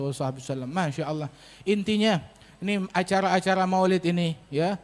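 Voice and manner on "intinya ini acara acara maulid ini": normal talking voice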